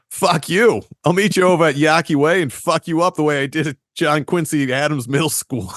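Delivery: mock Boston street brawler shouting threats; Boston accent. Thumbling as a Boston tough shouting at people, hammed-up Boston accent. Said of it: "Thumbling with a Boston accent".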